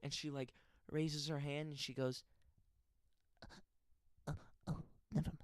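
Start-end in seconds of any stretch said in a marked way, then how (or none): 4.3-5.4 put-on breathy mousy girl. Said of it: "that's how she actually talks".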